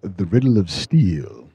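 put-on villain lecturing a boy, deep and emphatic; emphatic movie villain. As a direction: lean on every word like a warlord teaching a boy. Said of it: "He was so emphatic".

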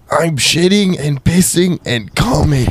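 slow, high put-on comedian voice; Gilbert Gottfried. Podcaster answers impression request, drawn-out comedian caricature. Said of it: "Gilbert Godfrey".

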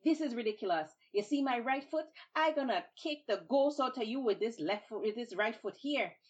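loud, high exasperation; fed up, threaten again; exasperated threat. Acted. Babuki, foot stuck, loud and exasperated. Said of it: "And he said".

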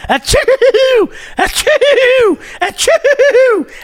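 high, exaggerated sneezes; mock sneezing fit; fake sneezing. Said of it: "he's just going, like".

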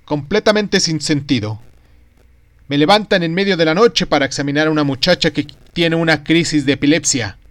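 loud, indignant doctor's complaint; furious rant. Acted. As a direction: rant about being woken at night. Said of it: "Estaba furioso e injuriaba ruidiosamente".

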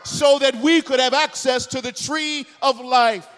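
rising, loud, high preacher's cry; preaching at full force. Acted. Pastor proclaiming the cross to his congregation, shouting. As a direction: build it up and proclaim it to the room.

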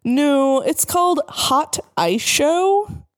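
high, slow, puzzled correction; confused. A narrator's reading. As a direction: draw it out, unsure of the name. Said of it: "He frowned confusedly".

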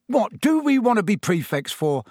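high-pitched, appalled protest; revolted. A narrator's reading. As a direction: balk at the very idea. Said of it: "looking revolted at the very idea".

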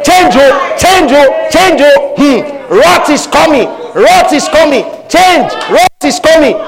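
high, loud shout of warning. Acted. The Holy Ghost warning the sinner, high loud shout. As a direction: shout it like a prophet of doom.

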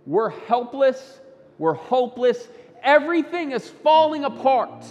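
frantic despair. Acted. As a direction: build in pitch and force as everything collapses. Preacher voices frantic, helpless people.

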